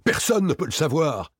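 high, agitated denial; panicky, high-pitched insistence; alarmed protest. A narrator's reading. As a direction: cry it out in alarm. Frightened Victor protests.

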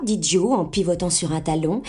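ordinary delivery, nothing marked

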